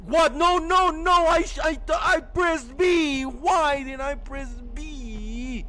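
high frantic yelling; panicked shout. Real, not acted. Panicked mistake in game.

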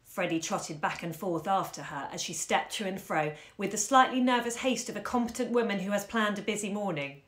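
usual speaking voice

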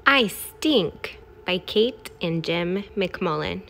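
ordinary delivery

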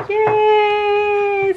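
high mock cheer. Wife mock-cheers that husband sleeps alone, high and playful. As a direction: fake delight, bright and high.

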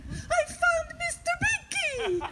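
high-pitched elated squeal; excited announcement. Acted. Caller blurts the good news to a pet's owner, excited squeal.

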